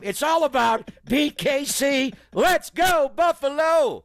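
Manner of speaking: high, slow shouted slogan. Acted. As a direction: bellow the slogan to the crowd. Same slogan shouted vs chanted.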